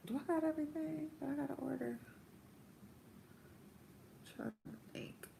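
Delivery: quiet, quick muttered self-check; talking to oneself. Real, not acted. Aside to self while ordering.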